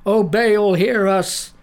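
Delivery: high, loud pleading cry. A narrator's reading. Prophets of Baal begging their god, loud cry. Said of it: "they cried".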